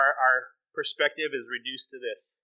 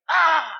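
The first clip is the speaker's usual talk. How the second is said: short high yell of frustration; hammed-up gamer's cry on losing; sudden loud, high "ah"; frustrated yell; 12 semitones higher, 7 dB louder than usual. Acting his reaction to losing game.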